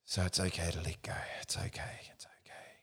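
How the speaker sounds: put-on fading whisper, like a mother to her child; soothing whisper. Shown as a demonstration. Coach soothing his own body like a mother, whispering. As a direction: hush yourself like a child. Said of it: "like a mother would say to its child".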